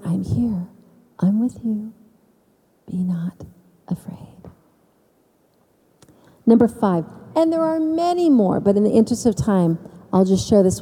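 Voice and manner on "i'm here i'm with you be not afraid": soft, quiet, fading to a whisper; hushed voice of God. Acted. Voice of the Lord reassuring her, whispered softly. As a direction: breathe it out like a tender whisper.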